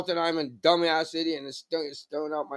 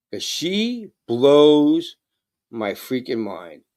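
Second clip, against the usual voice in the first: normal talking voice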